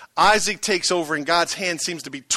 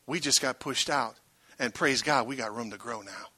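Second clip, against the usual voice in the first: lower, softer, calm and sure; calm faith; 5 semitones lower, 7 dB quieter than usual. Shown as a demonstration. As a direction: say it with settled trust. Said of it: "Or you can make this statement".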